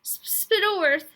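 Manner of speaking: put-on a crying boy naming his father's killer; sobbing. A narrator's reading. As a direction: choke the name out through tears. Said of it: "sobbed Roderick".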